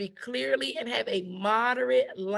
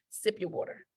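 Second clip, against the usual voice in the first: soft whispered cue; much softer, breathy aside; whispered reminder; 7 dB quieter than usual. Acted. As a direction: whisper it like a secret tip. Tip to pause and sip.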